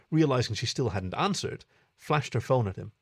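ordinary delivery, nothing marked

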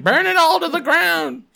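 high, loud mock rage. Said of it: "fucking [name]'s like".

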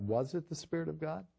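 ordinary delivery

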